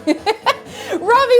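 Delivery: usual speaking voice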